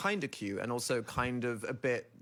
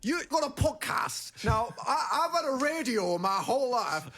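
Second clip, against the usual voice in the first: put-on old northern man; 11 semitones higher than usual. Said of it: "impersonating the old neighbour".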